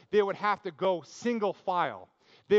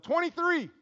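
called out high across the lunch table; yelled number; 11 semitones higher, 3 dB louder than usual. Retold or imitated. Worker yells a number at lunch, high shout. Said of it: "somebody yells out".

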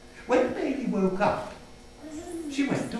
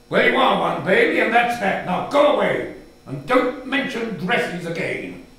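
louder, final and firm; sending the child off sharply; loud dismissal; 6 dB louder than usual. Acted. Father orders child away, loud and firm.

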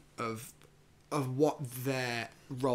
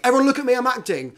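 mock showy actor; 11 semitones higher, 10 dB louder, faster than usual.

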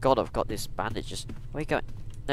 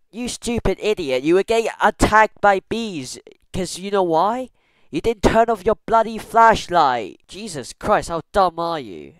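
loud exasperated scolding; mock scolding; 5 semitones higher, 9 dB louder than usual. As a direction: tell yourself off, exasperated. Editor scolding past self.

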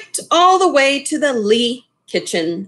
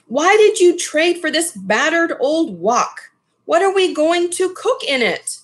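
higher, scolding mother's voice; upset mother; 7 semitones higher than usual. Acted. Mother scolding her son over the battered old wok. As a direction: demand answers like an exasperated mom.

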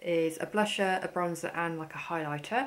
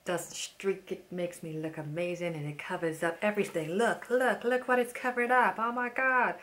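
imitating the salesman's gushing American accent, hammed up; over-the-top impression of a gushing salesman; mock shop assistant accent. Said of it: "I'm just insulting him, this man, with my accent".

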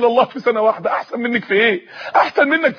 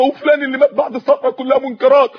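ordinary talk

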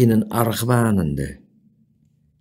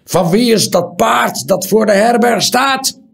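much higher, loud angry call; hard loud voice; 13 semitones higher, 6 dB louder than usual. A narrator's reading. Hard loud call. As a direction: horse owner shouts from the doorway. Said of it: "een harde stem riep".

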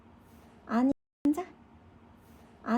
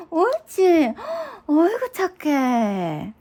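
loud sing-song praise; talking to a dog; 4 semitones higher, 8 dB louder, slower than usual. Real, not acted. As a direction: cheer the pet on. Coaxing an animal to eat.

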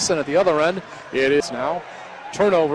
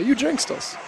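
higher, teasing whine of a sulking fan; mock whiny complaint; 11 semitones higher than usual. Announcer mimicking losing teams blaming him, mock whine. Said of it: "they always say".

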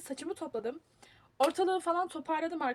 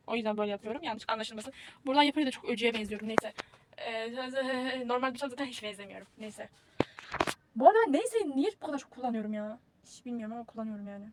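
ordinary talk, nothing marked

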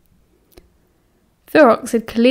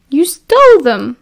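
loud, higher-pitched astonishment; shocked exclamation; 5 semitones higher, 6 dB louder than usual. A narrator's reading. Sirius learns his friend stole cigarettes, loudly astonished. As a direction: burst out in scandalised surprise.

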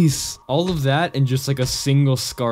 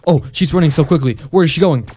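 ordinary delivery

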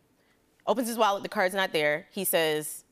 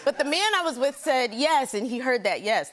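usual speaking voice

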